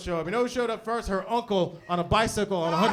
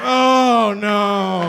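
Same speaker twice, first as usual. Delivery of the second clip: dread; 7 dB louder than usual. Retold or imitated. Fearing prison recognition.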